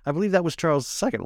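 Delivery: put-on a light, fast British accent with a laugh; mock British voice. Podcast host using a joking British accent. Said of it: "in my British voice".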